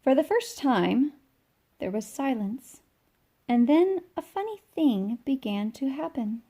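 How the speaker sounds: put-on lively read-aloud for children; bright storybook narration; storytime reading. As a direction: read it like a picture book to kids. Reading picture book aloud.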